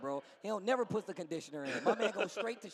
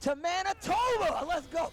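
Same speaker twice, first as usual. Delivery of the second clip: high, drawn-out hype shout; excited, high-pitched rallying cry; hyped call; 11 semitones higher, 4 dB louder, slower than usual. Real, not acted. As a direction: call it out high to fire up a crowd.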